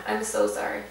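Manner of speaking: a breathy, unvoiced sorry under the breath; whispered apology. Real, not acted. Sister apologizing for botching a name, whispered. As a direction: whisper it like an embarrassed aside.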